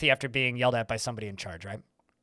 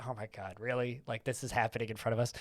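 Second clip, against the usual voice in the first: a stunned, much softer aside; hushed disbelief; 5 semitones lower, 11 dB quieter, faster than usual. Retold or imitated. Friends murmuring in shock while watching a stranger cry, hushed disbelief. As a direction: murmur it so the crying girl can't hear.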